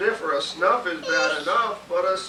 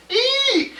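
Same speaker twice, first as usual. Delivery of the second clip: very high loud eek; frightened shriek; 7 dB louder than usual. Acted. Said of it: "shouted the elephant".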